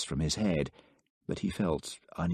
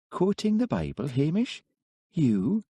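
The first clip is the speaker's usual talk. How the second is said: higher, teasing disbelief; lightly mocking surprise, pitched up; amused surprise; 10 semitones higher than usual. A narrator's reading. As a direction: she can't quite believe it of him. Elspeth amused at Hamish.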